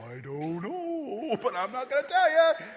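put-on small kid refusing to explain the drawing; child voice. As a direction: drag it out like a stubborn little child. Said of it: "your grandkids or your kids come up to you".

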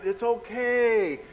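high, drawn-out placating voice; retold reassurance. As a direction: stretch it out to calm someone panicking. Retold calming of panicking daughter.

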